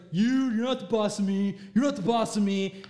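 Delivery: loud mock teenage backtalk; defiant teen. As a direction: push back at a parent, loudly. Acting out defiant teens to parents.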